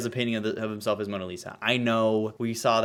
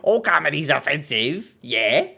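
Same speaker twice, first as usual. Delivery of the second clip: louder, higher smug comedian parody; mock smug comedian; 6 semitones higher, 6 dB louder than usual. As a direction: sneer it like a defensive comic. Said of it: "he's like".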